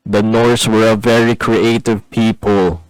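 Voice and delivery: loud, slow, deliberate aside; mock proclamation. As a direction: announce it ponderously as a deadpan gag.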